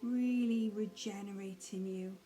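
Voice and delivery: soft, unhurried meditation guide with long pauses; hushed, calm mindfulness voice; guided meditation. Acted. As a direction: gently settle the listener into breathing, leaving space between phrases.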